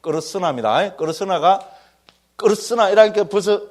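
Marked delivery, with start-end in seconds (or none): 2.4-2.9 끄르스나 with the tongue rolled on 르. Shown as a demonstration. Teacher showing the harder Sanskrit word, tongue rolled. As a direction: roll the r in 끄르스나, raised pitch. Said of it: "쇠를 좀 굴려야 되죠".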